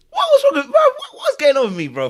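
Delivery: very high, loud bewildered cry; incredulous outburst. Real, not acted. Very high, loud disbelief at his own lost words to the chat. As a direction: squeal it in total disbelief.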